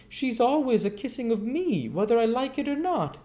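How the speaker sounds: high snivelling apprentice blaming her; blubbering. Acted. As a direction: whimper out the excuse.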